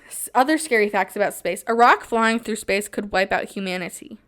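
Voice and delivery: normal talking voice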